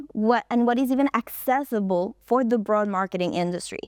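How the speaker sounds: normal talking voice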